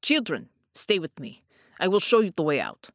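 lightly voiced gruff soldier; a hint of a stern Russian man asking; gently questioning a child, lightly gruff; Untermensch. A narrator's reading.

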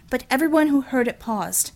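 plain reading voice

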